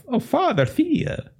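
high hammed-up butcher cry; mock exclamation. Game master tries a silly butcher catchphrase, mock exclamation. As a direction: pitch it way up as a silly wail.

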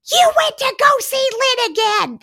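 high, indignant child imitation; mock-angry child, very high pitched; mad kid. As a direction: accuse them in a squeaky mad kid voice. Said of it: "They're so mad".

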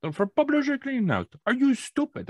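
high-pitched, exasperated Jamaican caller; heavy Jamaican accent. Retold or imitated. Caller losing patience with the man, heavy Jamaican accent. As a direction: imitate a fed-up Jamaican telemarketer. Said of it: "Very heavy Jamaican accent".

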